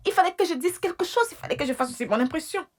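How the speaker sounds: high, pleading self-justification; defensive excuse. Acted. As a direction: protest that you had no choice. Defends her lie to husband.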